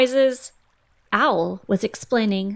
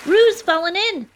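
high, loud cry of alarm; 11 semitones higher, 5 dB louder than usual. A narrator's reading. Rabbit crying out that Roo fell in, high alarmed shout. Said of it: "cried Rabbit".

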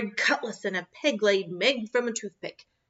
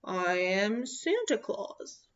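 gentle reveal; 6 dB quieter, slower than usual. Acted. Santa reveals himself gently.

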